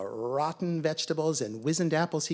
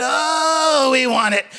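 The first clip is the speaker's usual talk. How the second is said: high, loud wail of wanting; big groaning "oh" of surrender; desperate giving in; 10 semitones higher, 14 dB louder than usual. A narrator's reading. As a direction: cry out, unable to resist. Cats cave in to temptation.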